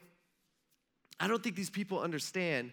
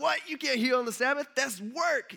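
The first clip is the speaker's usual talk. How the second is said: high, loud mock outrage; raised, disbelieving protest; incredulous objection; 8 semitones higher, 4 dB louder than usual. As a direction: act scandalized, pitch jumping up. Leaders react to a Sabbath healing.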